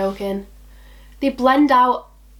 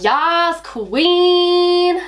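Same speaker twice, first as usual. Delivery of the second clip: high, long-held exclamation, hammed-up; over-the-top Yas Queen; 8 semitones higher, 4 dB louder than usual. Shown as a demonstration. Showing how she has to read the phrase, over-the-top and drawn out. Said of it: "I've gotta be like".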